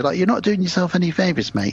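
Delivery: ordinary delivery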